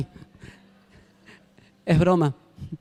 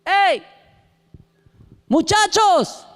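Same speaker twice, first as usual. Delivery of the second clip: high, slow hail across the water; calling out from shore; 9 semitones higher, 4 dB louder, much slower than usual. Acted. Man on the shore hails the fishermen in a long, high call. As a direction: shout over the water to the boat.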